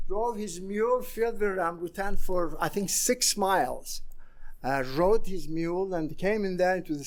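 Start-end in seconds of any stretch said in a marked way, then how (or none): none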